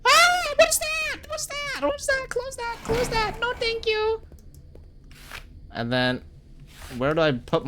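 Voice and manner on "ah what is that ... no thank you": very high squeaky mock panic voice; squeaky falsetto. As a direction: squeal the panic like a tiny cartoon creature. Panicking at something in game.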